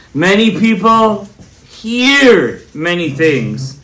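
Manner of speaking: loud preacher's delivery, key words stretched out; emphatic preaching. Real, not acted. Rabbi answering a doubter before listeners, emphatic preaching. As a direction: drive each point home to the congregation.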